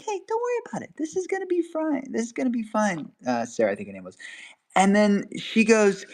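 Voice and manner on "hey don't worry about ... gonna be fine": high, soft, soothing voice; calm her down gently; lightly voiced reassurance to a scared friend; reassuring. Calming the shaking volunteer.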